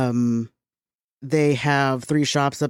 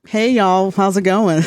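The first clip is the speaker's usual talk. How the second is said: loud, high Southern "Hey, y'all" greeting; mock Southern drawl, friendly and big; imitating a Southern shop clerk in a hammed-up accent; 5 semitones higher, 4 dB louder, slower than usual. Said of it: "I would think it's normal to be like".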